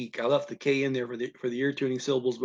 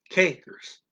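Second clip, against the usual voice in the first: "kijkers" with the first syllable raised in pitch; stressed first syllable; 4 semitones higher, 4 dB louder than usual. Shown as a demonstration. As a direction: lift and stress the KIJ of "kijkers", then drop. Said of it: "it's an elevated stressed syllable".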